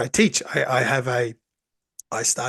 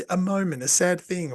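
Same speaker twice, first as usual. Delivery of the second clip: ordinary delivery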